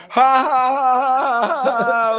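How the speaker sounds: slow, spaced-out mock ha ha ha; imitating a friend's laugh; imitated laugh. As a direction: mimic a booming laugh from afar. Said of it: "I heard the laugh".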